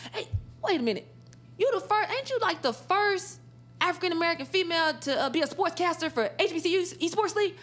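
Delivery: excited Doctor James. Retold or imitated.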